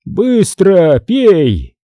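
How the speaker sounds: very slow, drawn-out order; lightly voiced chief giving a command. A narrator's reading. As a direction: order it with heavy, stretched words. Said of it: "приказал Джекил".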